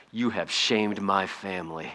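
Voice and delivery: slow, soft mock-dramatic grievance; a hint of theatrical offense over nuggets; mock dishonored outrage. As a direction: play it like a wounded samurai, understated. Mock drive-through outrage.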